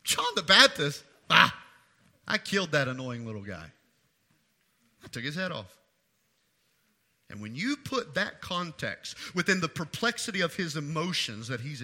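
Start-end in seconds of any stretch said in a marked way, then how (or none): 0.0-5.7 Herod, somewhat laughing. Acted. Preacher as Herod scoffing at beheaded John, half laughing. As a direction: toss it off with a dismissive mock laugh. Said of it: "It's as if he's somewhat laughing".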